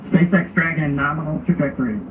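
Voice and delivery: crackly radio transmission; breaking up space radio. Real, not acted. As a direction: voice over a mission radio loop. Radio callout reply.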